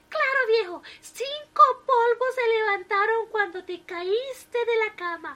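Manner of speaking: the wife teases her hurt husband; teasing wife. A narrator's reading. Wife teases husband after fall.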